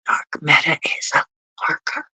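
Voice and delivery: teasing stage whisper; playful whisper. Pretend. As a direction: whisper it like a mock secret. Teasing a chatter as lurker.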